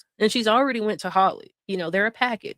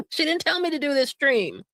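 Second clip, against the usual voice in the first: higher mocking voice through laughter; mocking imitation; 5 semitones higher than usual. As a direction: mimic her denial with a laugh. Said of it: "she'll say".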